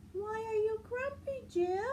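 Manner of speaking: high, bright questioning voice; Marabou asking. Acted. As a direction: ask it up high and cheerful. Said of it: "asked Marabou".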